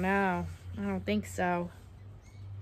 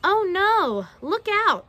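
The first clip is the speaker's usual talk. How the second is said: loud, high storybook warning; acted alarm; 7 semitones higher, 8 dB louder, slower than usual. Reader warning that the sharks are back, acted alarm. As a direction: cry out a playful danger warning.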